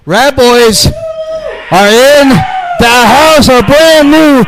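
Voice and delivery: slow, high, loud ring-announcer call; announcer shout. Acted. Interviewer introduces the new tag champions, big announcer shout. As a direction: bellow the intro to the whole arena.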